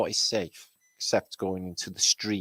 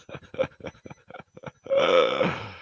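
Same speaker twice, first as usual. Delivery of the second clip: wheezy drawn-out laugh; 3 semitones lower than usual. Real, not acted. Streamer tickled by the chat, laughing. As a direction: crack up and wheeze it out.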